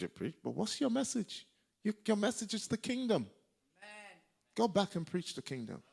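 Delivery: high, insistent correction; imitated mentor's reproof. Mama Sharon telling him his message, imitated high insistent reproof.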